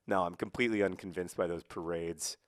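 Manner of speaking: usual speaking voice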